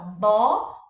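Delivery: "bola" in two beats, bo... la; syllable by syllable. Shown as a demonstration. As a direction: separate "bola" as if writing it in two parts. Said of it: "você vai separar a sílaba".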